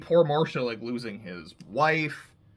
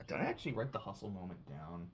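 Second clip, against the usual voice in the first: "did I actually write the hustle moment down" muttered much softer and fast, under the breath; muttering to self; 7 semitones lower, 15 dB quieter, faster than usual. Real, not acted. Host checking her notes, muttering the question to herself. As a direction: quietly ask yourself while checking notes. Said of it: "I actually didn't write the hustle".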